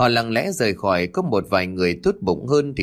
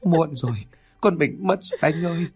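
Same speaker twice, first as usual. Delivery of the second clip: wailing grief; 4 semitones higher than usual. A narrator's reading. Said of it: "rồi gào lên".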